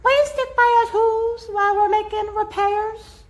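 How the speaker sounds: high small pleading voice; tiny pleading mayor. A narrator's reading. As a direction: beg like a little mayor. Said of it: "pleaded the voice".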